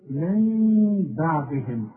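من بعدهم with slow hummed meem on من; iqlab ghunna. Shown as a demonstration. Teacher recites example for class, slow ghunna. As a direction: hold the nasal ghunna. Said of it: "شوف".

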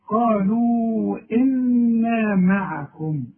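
slow, measured قالوا إنا معكم; slow tajweed recitation. Shown as a demonstration. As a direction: recite it slowly and evenly. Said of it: "شوف انت قلتها صحيحة". (تلاوة بطيئة مرتلة)

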